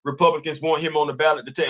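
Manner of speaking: ordinary delivery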